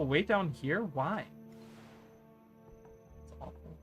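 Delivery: ordinary talk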